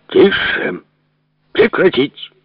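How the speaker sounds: high, curt cry of command; guard's shouted order. Acted. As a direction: order them to stop in a high, strained voice. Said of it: "крикнул подбежавший к глазку дежурный". (окрик дежурного, высоким голосом)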